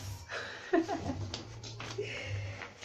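soft self-amused laughter; real chuckle. Real, not acted. Host admitting to viewers she skipped reading the manual, amused chuckle.

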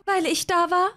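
eine etwas höhere, gezierte Hazel-Stimme; Hazel voiced. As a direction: spiel Hazel leicht höher, kokett. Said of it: "Hazel zu spielen ist so anstrengend". (Hazel, leicht höher gesprochen, schwärmt Edgar vor)

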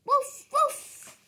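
high playful woof; high puppy bark. Acted. Boy barking as Biscuit. Child barks.

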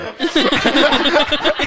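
very high, loud burst of laughter. Real, not acted. Laughing at the immigration joke, a very high loud laugh. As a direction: crack up at the thought.

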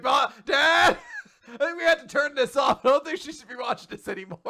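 high-pitched mock worry; alarmed kid. Child telling Dad to turn it off, mock alarm. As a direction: call to a parent through laughter.

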